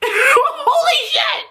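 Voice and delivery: high, drawn-out squeal of shock; stunned, high-pitched outburst; excited exclamation. Real, not acted. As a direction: blurt it out in a high, amazed squeal. High shriek reacting to the show.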